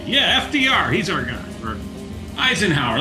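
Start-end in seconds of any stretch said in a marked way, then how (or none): 0.1-1.4 backroom crony. Retold or imitated. Backroom politicians voiced.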